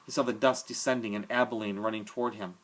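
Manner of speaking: plain reading voice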